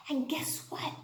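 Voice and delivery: hushed secret whisper. Acted. Teacher whispers a teaser to her kindergartners. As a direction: whisper it like big news.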